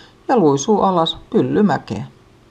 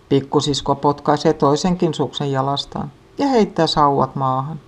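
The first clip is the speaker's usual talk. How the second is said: usual speaking voice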